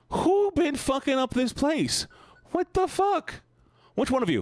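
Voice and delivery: very high, slow, drawn-out shriek; high-pitched demand for the culprit; outraged squeal. Real, not acted. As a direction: shriek the accusation at the room. Demands who drew on ceiling.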